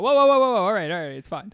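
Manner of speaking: a friend hearing too much, a loud startled whoa; mock alarmed whoa. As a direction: imitate a friend recoiling from oversharing, loud and high. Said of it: "They go".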